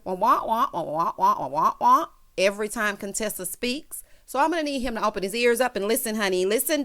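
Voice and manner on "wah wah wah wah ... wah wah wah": muffled trombone wah-wah nonsense; Charlie Brown's teacher. Retold or imitated. Husband tuning out wife's talk, mock trombone wah-wah. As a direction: mimic a droning muted horn voice. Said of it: "he just hears Charlie Brown's teacher".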